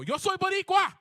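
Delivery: very high, very loud proud shout; defiant shout. Retold or imitated. Storyteller shouts back at the screaming girl. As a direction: hurl it back at her with pride. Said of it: "and I just go".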